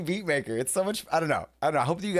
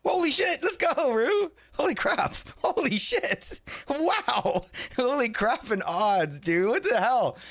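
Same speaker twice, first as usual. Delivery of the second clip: shocked hype; 14 semitones higher, slower than usual. Real, not acted. Streamer stunned by a viewer's huge gamble win, high and excited. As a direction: yell it out like you can't believe the win.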